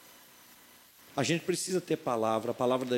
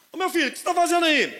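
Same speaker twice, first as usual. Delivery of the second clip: calling out; 13 semitones higher, 4 dB louder, faster than usual. Retold or imitated. Pai chama o filho.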